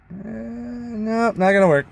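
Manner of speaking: mock dismay. Real, not acted. Fails to show pipe on camera.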